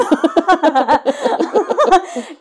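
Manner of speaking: a long, high, loud laugh; hearty laugh. Real, not acted. Recalling the boys at prom dinner, laughing hard. Laughs after the boys' line.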